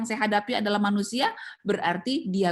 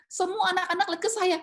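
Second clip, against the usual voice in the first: ordinary talk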